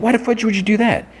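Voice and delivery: high, outraged disbelief; mock outrage. [name] confronting John about the booking, outraged and high. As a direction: pitch it way up in shocked anger.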